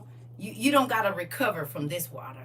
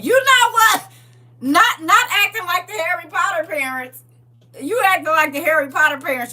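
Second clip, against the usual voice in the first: high, loud teasing holler; mock outraged yell; 10 semitones higher, 12 dB louder than usual. Preacher teasing parents who chaperone, in a mock outraged yell. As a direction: call it out at full volume, hamming it up.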